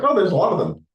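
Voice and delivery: high, loud mock gasp of surprise; hammed-up overwhelmed exclamation. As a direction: play it like being swamped by too many choices.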